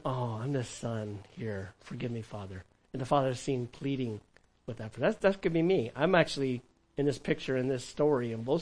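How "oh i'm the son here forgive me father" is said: much softer, slow and low, mock-contrite; mock humble plea. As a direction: play the sudden penitent, hushed. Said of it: "And then all of a sudden".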